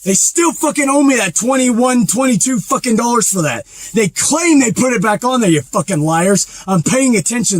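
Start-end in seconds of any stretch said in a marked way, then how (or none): none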